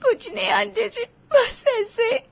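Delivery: very high, tearful brush-off; crying. A narrator's reading. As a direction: sob while pretending it is nothing. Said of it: "रो क्यों रही हो". (रोते हुए टालना)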